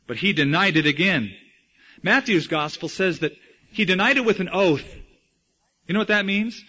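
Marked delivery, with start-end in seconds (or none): none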